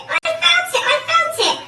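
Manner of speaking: high, loud agitated repeat; shouted. A narrator's reading. Mister Twit insisting he felt the droppings, shouted. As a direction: shout it urgently at high pitch. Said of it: "shouted Mister Twit".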